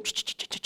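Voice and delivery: whispered rapid "cetak, cetak, cetak" like abacus beads; whispered clicking. Retold or imitated. Friend working the abacus, quick whispered "cetak" clicks. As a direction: tick off the beads under your breath.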